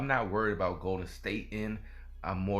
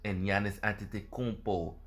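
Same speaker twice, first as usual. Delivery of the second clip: put-on a playful foreign-sounding accent on Antetokounmpo; lightly hammed-up accent on the name. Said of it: "Excuse that, uh, accent".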